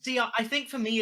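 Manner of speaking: ordinary delivery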